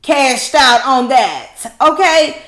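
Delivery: loud, high, very slow gloat; drawn-out gleeful high shout. Real, not acted. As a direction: stretch it out in glee. Gleeful punchline.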